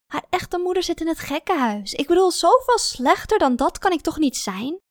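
high-pitched, worked-up protest; indignant venting. A narrator's reading. Woman protesting to her sister by text, indignant venting. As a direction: protest as if it's unfair. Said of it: "Ik moest even uitrazen tegen iemand".

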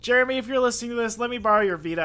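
loud, fast call-out, playfully begging; mock plea to absent friend. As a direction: shout it fast at Jeremy like he's listening.

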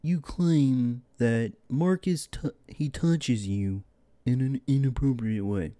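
slow, drawn-out talk-show doctor voice; Doctor Phil impression. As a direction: play a TV doctor grilling a guest, slowly. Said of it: "He kinda does sound like the dude from South Park".

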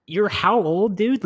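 high, loud disbelief at the number; incredulous. Retold or imitated. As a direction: throw it back at him in disbelief.

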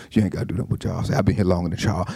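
low quick mocking gossip; sly gossip. Gossip undermining Charles.

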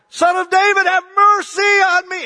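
full-volume, high desperate scream. Acted. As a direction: crank it all the way up. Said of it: "He got even louder".

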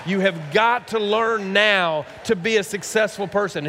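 high, emphatic fatherly lecture; impassioned retold scolding; stern lecturing dad. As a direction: drive each word home to a teenager. Acted lecture to his son.

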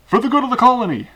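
proud zealous cheer; mock patriotic.

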